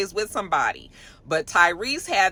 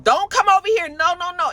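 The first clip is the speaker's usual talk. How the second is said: high, loud panicked yell; reliving a frantic shout at a loose dog; retold scream; 10 semitones higher, 4 dB louder than usual. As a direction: shriek at the dog to stay away. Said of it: "I was kicking and screaming".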